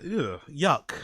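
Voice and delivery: low, quiet noise of disgust; muted, low revulsion. Real, not acted. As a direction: recoil softly, as if grossed out. Acts disgust at men's voice notes.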